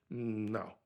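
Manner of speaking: softly and flatly turn it down; a soft, half-voiced no, it's not for sale; quiet firm refusal. Refusing a film crew's cash offer, quiet and firm.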